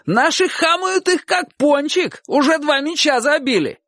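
very high, elated yelling; rapturous shout. A narrator's reading. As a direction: break the great news across the yard. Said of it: "восторженно закричал человек с крыши". (восторженный крик болельщика)

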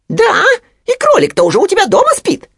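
insistent squeaky piglet, loud and fast; Хрюня. A narrator's reading. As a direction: keep pressing in a high squeal. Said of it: "не унимался Хрюня". (настойчивый быстрый писк поросёнка)

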